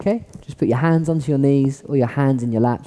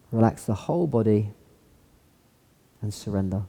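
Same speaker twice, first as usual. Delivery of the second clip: guided meditation; 3 semitones lower, 6 dB quieter than usual. Acted. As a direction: melt them into calm. Guides a body scan relaxation.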